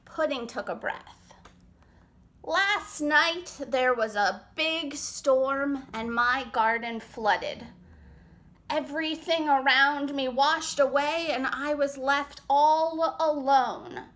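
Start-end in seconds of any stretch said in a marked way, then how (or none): 2.4-14.1 slow, drawn out, swelling on alone; slow sad snail. Acted. Snail slowly telling the forest animals about the flood. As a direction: let the sad words crawl out.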